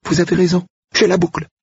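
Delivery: put-on high, eager young woman's voice; flustered young woman. Manon flustered.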